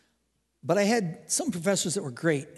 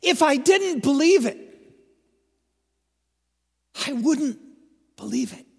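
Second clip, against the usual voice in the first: higher, louder, emphatic; louder, higher proclamation of disbelief; fervent repeat; 8 semitones higher, 5 dB louder than usual. Retold or imitated. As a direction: preach it out with rising awe. Repeats professor's words with force.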